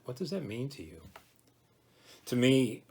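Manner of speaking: ordinary talk, nothing marked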